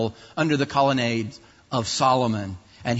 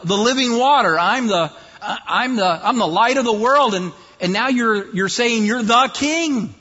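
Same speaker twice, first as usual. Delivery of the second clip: higher, louder mocking whine of annoyed leaders; sarcastic leaders; 6 semitones higher, 3 dB louder than usual. Preacher voicing leaders mocking Jesus's claims, sarcastic whine. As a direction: needle him, mockingly exasperated. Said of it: "if you can hear the sarcasm in their voice".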